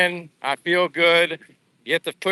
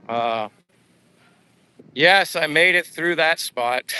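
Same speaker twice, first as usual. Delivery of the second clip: ordinary talk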